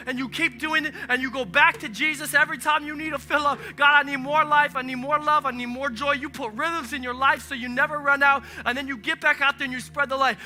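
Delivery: preaching. Acted. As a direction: drive it home to the congregation. Preaches at full force.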